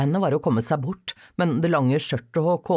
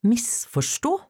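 incredulous echo; 9 semitones higher, slower than usual. A narrator's reading. Daughter throws his word back.

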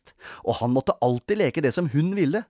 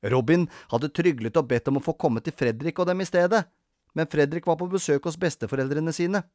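ordinary delivery, nothing marked

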